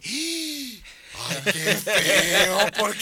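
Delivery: mock horrified exclamation; hammed-up shocked outburst; acted disgust. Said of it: "qué yo estoy actuando".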